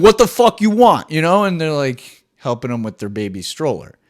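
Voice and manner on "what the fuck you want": loud, high mock yell of an angry New Yorker; New Yorker snapping at a stranger, mock yell. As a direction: bark it like a grumpy New Yorker. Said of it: "They're just sound mad".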